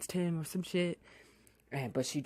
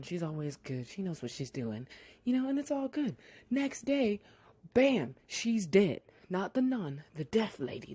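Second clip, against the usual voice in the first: normal talking voice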